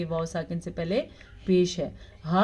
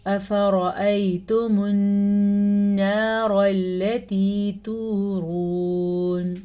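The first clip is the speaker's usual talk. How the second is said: slow melodic tajweed chant; measured Quranic recitation; 4 dB louder, much slower than usual. Shown as a demonstration. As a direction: recite slowly, drawing out each vowel. Recitation demo.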